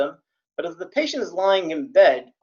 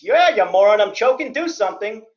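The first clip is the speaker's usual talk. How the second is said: mock angry victim yell; 4 semitones higher, 6 dB louder than usual. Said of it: "and says".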